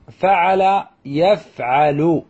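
فعل يفعل slowly as fa-'a-la, yaf-'a-lu; syllable by syllable. Shown as a demonstration. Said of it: "الباب الثالث".

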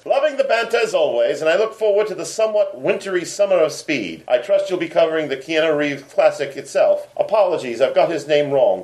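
reading a listener's letter in a hammed-up British accent. Podcast host reads British listener's letter aloud, mock British accent. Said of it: "in my accent".

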